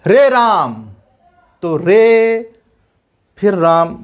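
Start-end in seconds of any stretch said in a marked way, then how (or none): 0.1-0.7 "रे राम" called loud, रे held long; calling out. Shown as a demonstration. Teacher demonstrates calling out रे राम. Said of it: "किसी को बुलाते हैं". 1.8-2.5 "रे" held long and raised. Shown as a demonstration. Teacher isolates the long रे. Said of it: "रे पे काफ़ी टाइम लगता है".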